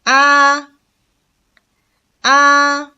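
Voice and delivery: "A" high, level and sustained; first, flat tone. Shown as a demonstration. Teacher reads syllables for learners, high flat "A". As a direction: hold "A" on one high note. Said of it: "attention to the tones".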